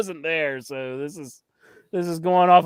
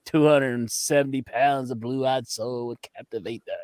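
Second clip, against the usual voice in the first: Dusty Rhodes; 6 semitones lower, 5 dB quieter than usual. Retold or imitated. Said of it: "He's, like, sitting there, he's like".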